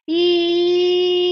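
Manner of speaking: long, loud, high ee; sustained "ee". Shown as a demonstration. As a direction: project ee on one steady note. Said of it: "We're gonna say".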